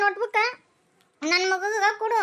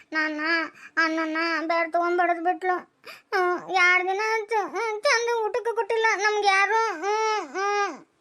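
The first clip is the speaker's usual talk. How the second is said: put-on crying child. Son tells father nobody fed them, crying. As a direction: sob through every line.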